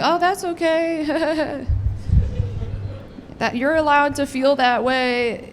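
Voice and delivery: slow, high, sugary reassurance; fake reassuring. Comic telling a kid it's fine, slow and sugary. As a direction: soothe him with mock sweetness.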